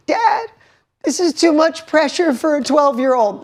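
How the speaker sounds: put-on high-pitched distressed child voice; imitated crying daughter. Daughter crying to Dad about pressure, imitated high distress. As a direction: mimic an overwhelmed kid pleading, pitched far up. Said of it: "Picture your ten or eleven-year-old daughter crying to you".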